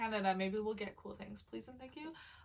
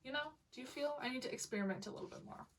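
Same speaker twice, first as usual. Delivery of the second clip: normal talking voice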